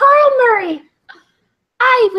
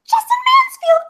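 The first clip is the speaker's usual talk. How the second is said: high-pitched squeaky thank-you; cute bird voice; 12 semitones higher than usual. Pretend. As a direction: talk like a tiny cute bird. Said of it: "weird high-pitched voice thingy".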